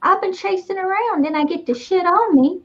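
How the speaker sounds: high-pitched creepy pretend fly character. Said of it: "this is a really creepy voice".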